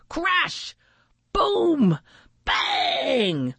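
exclaimed high, separated impact words; dramatic sound effects. A narrator's reading. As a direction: punch out each crash like a cartoon. Collision sounds read dramatically.

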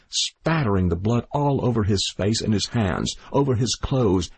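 plain reading voice

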